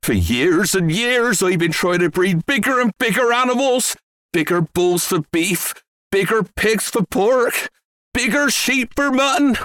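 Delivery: high, eager rant building upward; excited yell. A narrator's reading. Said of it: "Mister Cranky yelled".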